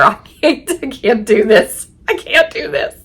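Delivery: high, helpless laughing protest. Real, not acted. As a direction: protest through real laughter, pitch climbing. Laughing while retelling her refusal.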